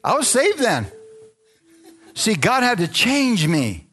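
high, passionate preaching; getting worked up in the pulpit; fervent. Real, not acted. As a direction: build with rising fervour. Preacher's fervour rising.

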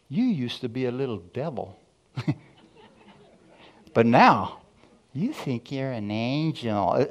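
taunting friend. Retold or imitated. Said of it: "that's exactly the way he said it".

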